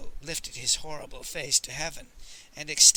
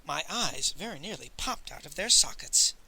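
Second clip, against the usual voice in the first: plain reading voice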